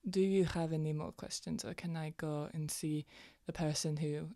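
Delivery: ordinary delivery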